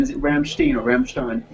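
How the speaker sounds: Rammstein first with German sh, then Rammstein ending in -stine; Rammstein pronunciation. Shown as a demonstration. Friend asking which way to say the band name, comparing pronunciations. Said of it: "going on the accent thing again".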